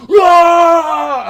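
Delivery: high, loud roar; crowd roar. Retold or imitated. Followers cheering the speech, loud roar. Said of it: "They're like".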